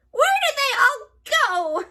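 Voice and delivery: high, loud baffled hag; shouting witch. A narrator's reading. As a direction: call out bewildered. Witch lands, finds no pigs.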